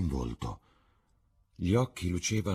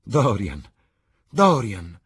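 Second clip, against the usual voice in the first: calling his name louder and higher; crying out; 4 semitones higher, 8 dB louder, slower than usual. Acted. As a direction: call out to him with rapture. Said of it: "gridò".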